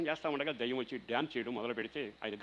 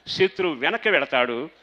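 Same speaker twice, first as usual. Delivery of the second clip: preaching at full force; 3 semitones higher, 15 dB louder than usual. Acted. Pastor exhorting the congregation to say amen, at full force. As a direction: thunder it at the congregation.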